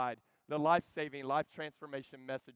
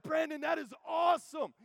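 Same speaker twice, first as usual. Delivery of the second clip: much higher, joyful yell; excited shout; 11 semitones higher, 5 dB louder than usual. Retold or imitated. Preacher hearing his friend got saved, shouting for joy. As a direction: shout it with pure delight. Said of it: "And I was like".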